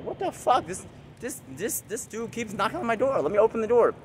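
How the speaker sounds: high put-on voice of a fed-up man behind a door; annoyed doorkeeper. Imagined man fed up with the knocking, high mock voice. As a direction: squeak it out like the guy inside who's sick of the knocking. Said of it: "that motherfucker's gonna be like".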